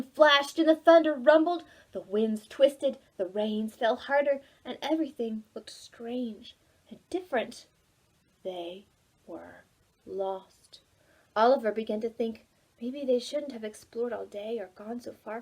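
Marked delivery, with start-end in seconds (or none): 4.6-10.8 soft, unvoiced whisper; hushed narration. A narrator's reading. Storyteller to children, whisper. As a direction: whisper in suspense.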